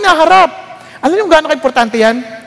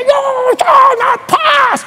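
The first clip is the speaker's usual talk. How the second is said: very slow, much higher Gandalf shout; mock Gandalf bellow, stretched out; Gandalf impression; 13 semitones higher, much slower than usual. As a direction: roar it like the wizard on the bridge. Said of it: "Sabi niya".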